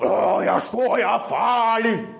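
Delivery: high, slow mock swagger; puffed-up boaster. Mocking the devil as a puffed-up boaster. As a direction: puff yourself up like a bully. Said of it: "pusse op".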